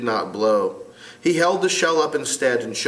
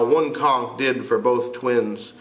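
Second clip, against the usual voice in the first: usual speaking voice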